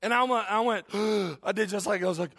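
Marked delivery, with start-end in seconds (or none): 0.9-1.4 sharp shocked intake of breath; gasp. Retold or imitated. Pastor realizing his text went to Steve, with a sharp gasp. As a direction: gasp as the mistake sinks in. Pastor retells seeing wrong recipient.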